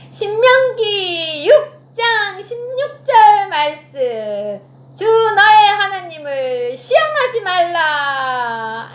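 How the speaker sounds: slow, loud declaiming of scripture; solemn proclamation. Acted. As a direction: pronounce it slowly like Jesus rebuking. 예수님의 말씀 선포. (천천히 크게 선포하는 말씀)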